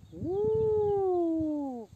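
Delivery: high drawn-out hoot; owl hoot. Shown as a demonstration. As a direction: hoot as you turn. Owl hoot while turning.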